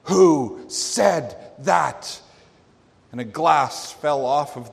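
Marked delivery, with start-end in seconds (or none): 0.0-2.2 loud, very slow, word-by-word demand; screamed demand. Retold or imitated. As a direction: pound out each word separately, like a fist on the table. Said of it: "pounded upon the table and screamed".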